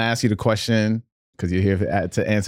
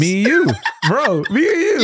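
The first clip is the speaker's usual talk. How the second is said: excited; 12 semitones higher, 3 dB louder than usual. Real, not acted. Excited shared sneaker memory.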